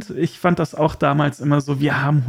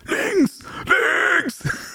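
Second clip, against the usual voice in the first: high yelled callers' command; shouting. Retold or imitated. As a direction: bellow it down the phone line. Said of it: "die haben so wirklich gebrüllt".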